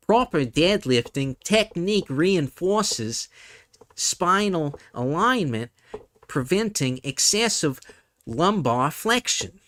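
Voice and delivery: lightly put-on New Zealand accent. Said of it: "a New Zealand accent".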